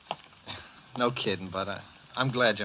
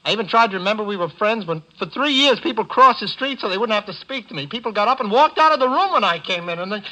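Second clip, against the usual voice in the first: rising higher and louder in bitter anger; bitter outburst; 8 semitones higher, 4 dB louder than usual. Acted. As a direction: let the old hurt build to a grievance. Joe's bitter outburst at Bud.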